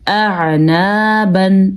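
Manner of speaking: slow اعنابن, hamza then throaty ع, kept apart; hamza and ain distinct. Shown as a demonstration. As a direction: separate the first two sounds of اعنابن clearly. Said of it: "واضح کر کے الگ کر کے پڑھا ہے". (اعنابن، ہمزہ اور عین الگ الگ واضح)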